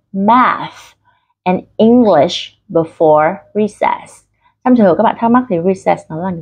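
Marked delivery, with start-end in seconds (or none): 0.1-4.2 very slow, stretched drill reading; slow, taut articulation. Shown as a demonstration. Teacher reminds learners to tense the mouth, slow exaggerated articulation. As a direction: draw each word out with a tensed mouth. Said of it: "căng miệng".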